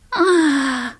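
high, loud sighing ah; a big theatrical witch sigh; witch's sigh. A narrator's reading. Little witch sighing at the boy, theatrical. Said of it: "seufzte sie".

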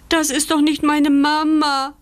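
high, loud wailing puppy; disappointed wail. Acted. Puppy sees a cow instead of his mother, disappointed wail. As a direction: wail out the letdown. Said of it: "heult er enttäuscht".

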